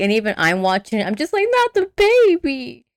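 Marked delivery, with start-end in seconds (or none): 1.5-2.8 high, drawn-out wail; mock horrified plea. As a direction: draw out a hammed-up plea. Own horrified reaction, acted out.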